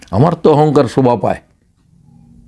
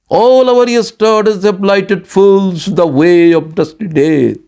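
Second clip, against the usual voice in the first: very slow, higher theatrical reading; soaring recitation; 7 semitones higher, much slower than usual. Acted. Preacher declaiming Macbeth again, slow and lifted higher. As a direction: lift the line up like a lament.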